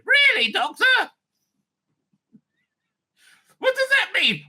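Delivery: very high-pitched companion voice; puzzled companion. Acted. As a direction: squeak the question up at the Doctor. Companion questioning the Doctor in sketch.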